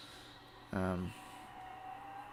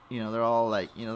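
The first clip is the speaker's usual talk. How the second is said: ordinary talk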